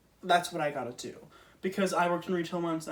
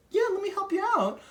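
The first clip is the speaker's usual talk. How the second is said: much higher, chirpy helpful clerk; eager helper voice; 14 semitones higher, 3 dB louder than usual. Retold or imitated. To the Target shopper, a high, eager helper voice. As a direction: play the eager store helper. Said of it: "I still was like".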